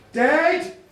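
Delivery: one loud, high, ringing call; commanding cry, punched out; loud challenge to the dead. Acted. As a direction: summon the dead with a bold shout. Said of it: "he took a breath, and he said".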